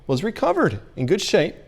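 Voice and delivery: usual speaking voice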